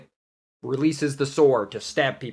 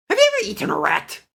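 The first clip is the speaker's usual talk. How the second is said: bard voice; faster than usual. Acted. Bard greeting Nico in a high put-on voice. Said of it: "voice acting".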